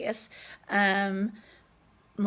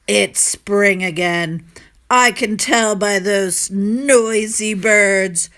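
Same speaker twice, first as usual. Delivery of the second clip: put-on loud, slow, complaining old landlord; grumpy old man; 9 dB louder, slower than usual. The mean landlord grumbling at noisy birds, loud and cranky. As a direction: grumble it like a crotchety miser. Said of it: "complained the old man".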